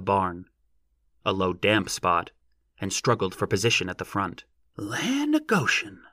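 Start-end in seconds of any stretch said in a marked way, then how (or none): 4.8-6.0 slow, high-pitched rustic amazement; subdued exclamation. A narrator's reading. Onlooker seeing the giant, subdued exclamation. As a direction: marvel slowly in a high, restrained voice. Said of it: "a subdued voice exclaimed".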